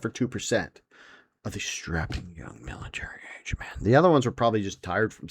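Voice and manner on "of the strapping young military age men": hushed aside. Acted. Podcaster joking about the strapping young men, hushed aside. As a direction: drop to a soft, low near-whisper.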